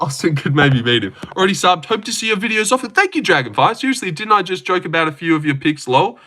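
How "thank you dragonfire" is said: usual speaking voice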